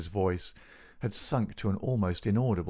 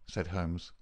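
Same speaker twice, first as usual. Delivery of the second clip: ordinary delivery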